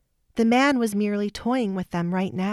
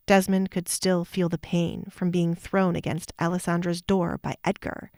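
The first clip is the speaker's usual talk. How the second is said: plain reading voice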